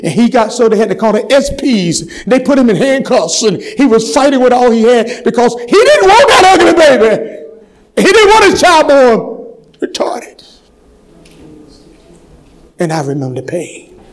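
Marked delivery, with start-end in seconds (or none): 0.5-10.4 high, rising cry from the pulpit; impassioned preaching. Real, not acted. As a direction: preach it, climbing into a sustained shout.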